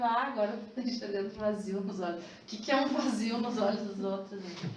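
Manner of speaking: lightly voiced mocking boyfriend. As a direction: tease her, half-joking and scornful. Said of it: "Começou a debochar de". (deboche leve, imitando o namorado)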